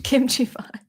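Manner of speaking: breathy whispered name. Real, not acted.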